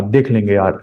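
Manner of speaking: lazy careless. Retold or imitated. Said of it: "lightly का मतलब क्या है कि".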